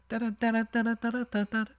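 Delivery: high sung da-da melody; imitating wind instrument. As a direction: hum the tune in a high voice.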